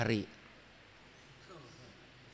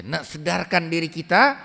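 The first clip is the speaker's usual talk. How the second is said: Malaysian Malay, emphatic; 4 semitones higher, 6 dB louder than usual. Retold or imitated. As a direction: repeat the Malaysian phrase louder with a rising end. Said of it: "kata orang Malaysia". (logat Malaysia, diulang lebih keras)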